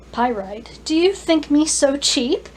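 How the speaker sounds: indignant question rising high and loud; mock offence. A narrator's reading. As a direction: take offence, half teasing. Dragon acts offended at teasing offer.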